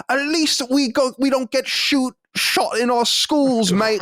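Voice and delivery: high-pitched, drawn-out British jibe; mock British accent. Mocking British banter about Americans.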